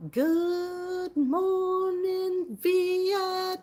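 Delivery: high, loud, hugely drawn-out radio-show yell; Robin Williams. Shown as a demonstration. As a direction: stretch every word into a long, high holler. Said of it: "You mean like Robin Williams?".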